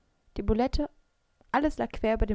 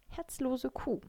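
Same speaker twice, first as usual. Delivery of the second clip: softer grumbled thought; grumbling; 4 semitones higher, 4 dB quieter, slower than usual. A narrator's reading. Maike grumbles at her heartless friend, muttered thought. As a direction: grumble it under your breath. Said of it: "dachte ich grummelnd".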